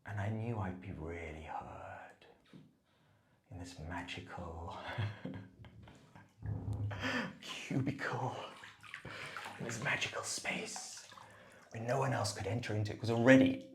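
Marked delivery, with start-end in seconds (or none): 0.0-11.0 hushed, slow and breathy; whispering. Real, not acted. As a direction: whisper it like a secret. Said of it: "hushed reverie".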